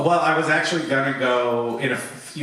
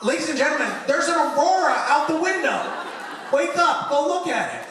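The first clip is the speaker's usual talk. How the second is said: high, urgent pilot announcement, exasperated; angry mock announcement; faster than usual. Pilot should wake passengers for the Aurora, angry mock announcement. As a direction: rouse the whole cabin in mock indignation. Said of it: "It made me angry".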